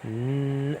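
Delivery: n- held as a long hum; sustained N sound. Shown as a demonstration. Teacher showing how the letter sounds, sustained N. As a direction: hold the bare n- as in Nancy. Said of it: "you are to pronounce it".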